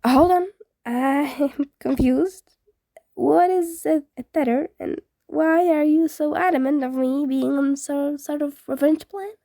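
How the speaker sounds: a half-laugh of disbelief; amused confusion. Acted. Ghost confused by Izuku's questions, laughing lightly.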